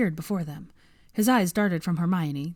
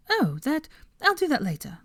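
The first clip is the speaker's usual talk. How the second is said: distracted; 8 semitones higher than usual. A narrator's reading. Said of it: "said Hermione distractedly".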